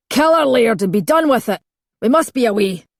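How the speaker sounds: urgent hiss. A narrator's reading. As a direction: push the laird to kill her, now.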